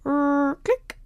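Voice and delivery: imitated tape whirr and click; high-pitched mimicked machine sounds; tape recorder noise. A narrator's reading. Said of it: "went the tape recorder".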